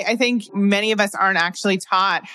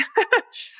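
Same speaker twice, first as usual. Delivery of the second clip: high squeal of laughter. Real, not acted. Reacting to husband's offer.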